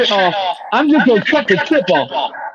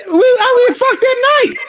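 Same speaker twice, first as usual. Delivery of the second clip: cracking outcry; much slower than usual. Real, not acted. Defending himself, voice cracks high.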